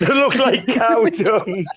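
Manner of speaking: laughing through the words; struggling not to laugh; stifled giggles. Real, not acted. Joke repeated with laugh.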